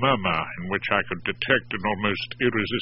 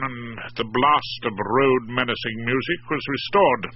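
plain reading voice

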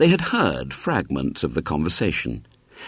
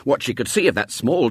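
ordinary delivery, nothing marked

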